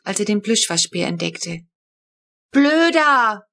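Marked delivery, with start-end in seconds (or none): 2.5-3.5 put-on high, loud childish insult; a little girl shouting back at her brother; child yelling. A narrator's reading. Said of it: "brüllte die Kurze".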